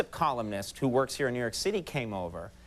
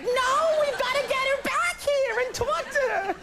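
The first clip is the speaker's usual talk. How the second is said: very high, screechy mock-mother falsetto; hammed-up motherly panic, way up high; imitated frantic mom. Comedian mimics mom wanting the columnist back, frantic falsetto. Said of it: "My poor mom was like".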